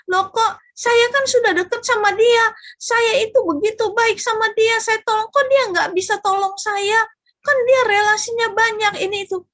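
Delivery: loud mock outrage at an unhelpful friend; indignant complaint. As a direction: act out the hurt, indignant complainer. Imagined indignant complaint about friend.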